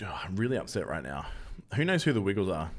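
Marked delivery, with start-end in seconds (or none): 0.1-1.3 mock-dramatic whisper, lower and softer. Streamer whispers a mock complaint to chat, whisper. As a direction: hush it like a confession.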